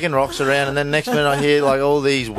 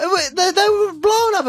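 high pitched squeal; 13 semitones higher than usual. Acted. Exaggerated high incredulous voice.

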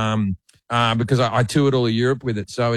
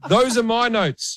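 higher, slow exasperated producer; mock producer; 8 semitones higher, slower than usual. Producer telling the host he went off script, slow and higher. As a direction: drag it out like a fed-up producer. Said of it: "he goes".

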